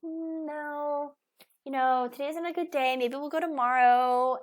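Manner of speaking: tired parent refusal. Retold or imitated. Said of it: "And you're like".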